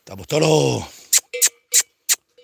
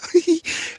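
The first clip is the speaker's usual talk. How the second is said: high amused laugh after the mockery; 4 dB quieter than usual. Real, not acted. Laughing at his own mockery of the protesters. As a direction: chuckle at his own joke.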